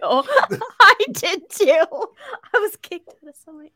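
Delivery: high laughing reply. Real, not acted. Laughing self-mockery.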